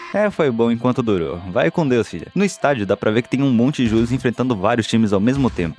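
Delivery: ordinary talk, nothing marked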